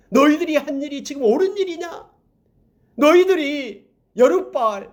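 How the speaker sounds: high, ringing proclamation from the mountain; Jotham crying out. Retold or imitated. Preacher voicing Jotham rebuking the men of Shechem: high ringing cry. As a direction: call down the valley to the people, pitched high. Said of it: "하면서 큰 소리로 이 요담이 막 외칩니다".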